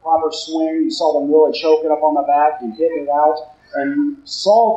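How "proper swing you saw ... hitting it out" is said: ordinary talk, nothing marked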